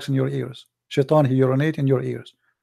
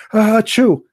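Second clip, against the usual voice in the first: loud playful fake sneeze; mock sneeze on purpose; 6 semitones higher, 6 dB louder than usual. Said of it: "Let us sneeze together".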